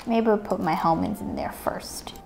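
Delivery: soft unvoiced whisper. Real, not acted. Filling the toy basket, a soft secret whisper.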